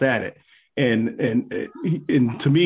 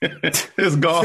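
it's golf said through a laugh; stretched laughing it's golf; 6 semitones higher than usual. Real, not acted. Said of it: "I'm not gonna laugh".

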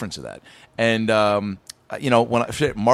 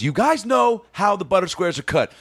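higher-pitched mock scolding; retold angry lecture; 7 semitones higher than usual. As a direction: imitate a worked-up kitchen boss. Retold boss lecturing angrily.

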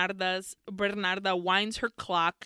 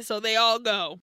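ordinary delivery

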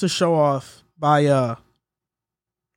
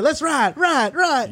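higher-pitched mimicry of a woman's catchphrase; 8 semitones higher, much slower than usual. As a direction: pitch it up and echo the last word. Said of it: "did you see Sierra when she said".

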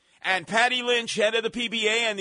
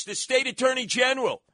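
ordinary talk, nothing marked

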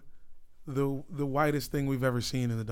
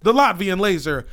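loud, raised nickname call; announcer-style hype; 4 semitones higher, 10 dB louder than usual. Pretend. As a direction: proclaim the nickname to the whole arena. Hypes player like an announcer.

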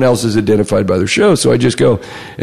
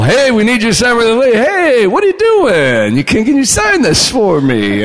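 higher-pitched mock caller, lightly pushy; pestering caller; 10 semitones higher than usual. Callers chasing him for a signed release, mock pestering. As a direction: imitate eager callers in a raised pitch.

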